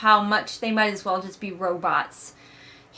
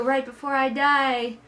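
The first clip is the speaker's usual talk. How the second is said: mock dying; 4 semitones higher than usual. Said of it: "Tobias is like".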